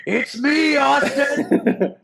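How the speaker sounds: playful imitation of Stone Cold's entrance line; Stone Cold Austin impression. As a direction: belt it like Austin on the mic. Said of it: "It's right up there with".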